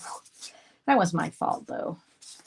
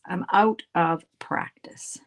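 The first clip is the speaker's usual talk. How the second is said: whispered; slower than usual. Acted. Repeats line in a whisper.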